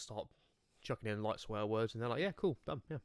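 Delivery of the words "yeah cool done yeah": "Yeah, cool, done, yeah" high and casual, consonants dropped. Retold or imitated. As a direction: imitate an easygoing customer, "cool, done" with loose word endings. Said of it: "you drop all the consonants".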